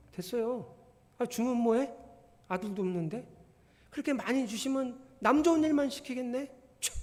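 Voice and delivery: high-pitched cheeky back-talk to God; insolent. Shown as a demonstration. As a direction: brush off the offer with mock indignation. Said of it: "뭐 이런 건방진 놈이 다 있어".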